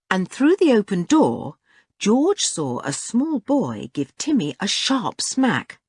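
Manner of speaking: plain reading voice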